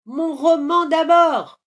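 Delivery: put-on loud, high-pitched boy's yell; insistent little boy, loud and high; defiant child's shout. A narrator's reading. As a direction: shout back stubbornly like a kid. Boy shouts back from the roof.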